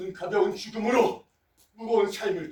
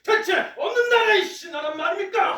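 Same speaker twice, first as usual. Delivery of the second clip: higher, sharp accusing challenge; indignant outburst; 7 semitones higher, 4 dB louder than usual. Acted. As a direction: hurl the accusation. Minister accuses rival in council.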